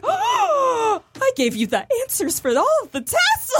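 high, shocked, wounded protest; indignant outburst. Retold or imitated. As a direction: cry out in betrayed outrage. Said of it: "I was like".

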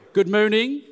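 put-on comic French-accented English. Imitating a sitcom character for the congregation, comic French accent. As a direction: call it out in a mock French accent. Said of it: "Do you remember that guy?".

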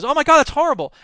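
high, loud mock horror; horrified duck. Duck in Babe watching a pig get killed, horrified. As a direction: exclaim in shock, pitched well up.

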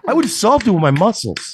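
put-on a concerned neighbor in a Boston accent; concerned Mark Wahlberg. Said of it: "impression of a concerned citizen Mark Wahlberg".

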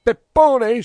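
very high, loud call of a name; urgent, piercing yell; shouted. A narrator's reading. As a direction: shout it right in his ear. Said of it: "shouted Brusco in his ear".

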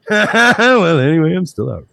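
high mock Homer voice; imitating Homer. Host imitates Homer line.

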